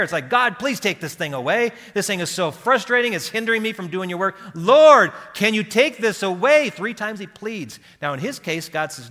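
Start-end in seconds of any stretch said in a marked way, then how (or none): 0.3-6.7 louder, higher desperate plea; fervent pleading, rising to a cry on Lord; pleading prayer. Acted. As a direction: beg God to take it away. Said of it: "not a little nice in your head prayer".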